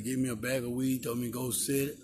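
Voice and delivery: ordinary talk, nothing marked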